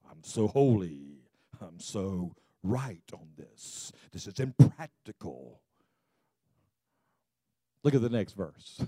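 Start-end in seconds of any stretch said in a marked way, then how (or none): 0.1-5.6 lower, mocking self-righteous drone; mock holier-than-thou. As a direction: send up a smug know-it-all. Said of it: "that's kind of the way he talked".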